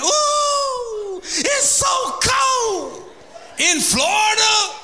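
very high, slow, shrill-free exaggerated whine; mock whining complainer. Preacher mimics church folk moaning about the Florida cold.

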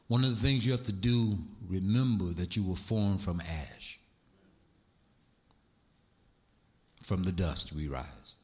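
low, steady sermon voice; calm preaching. Acted. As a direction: settle back into teaching, low and calm. Calm low teaching after the rant.

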